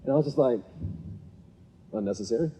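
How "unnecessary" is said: understated reaction. Retold or imitated. His muted reaction to the yelling.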